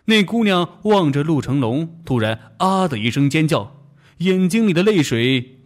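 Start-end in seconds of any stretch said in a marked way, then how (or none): none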